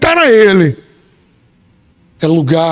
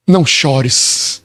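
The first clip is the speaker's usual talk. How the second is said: tender comfort; 7 semitones lower, much slower than usual. Retold or imitated. Jesus comforting the widow, low and slow. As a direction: soothe her gently. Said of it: "e ele diz".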